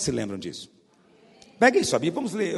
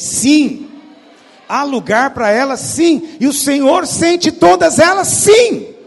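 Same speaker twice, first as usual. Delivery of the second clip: from loud to a much higher, very loud shout; building preached shout; 7 semitones higher, 12 dB louder, slower than usual. Acted. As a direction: build each yes bigger. Preacher's rising affirmations.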